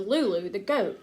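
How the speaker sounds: ordinary delivery, nothing marked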